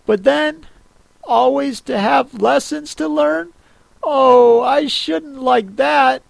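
put-on high young girl's voice, wondering; Alice talking to herself. A narrator's reading.

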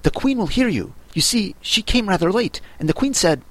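high, nervous rabbit gossip; a touch of alarm; mildly frightened. A narrator's reading. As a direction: confide it anxiously in a small high voice. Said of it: "whispered in a frightened tone".

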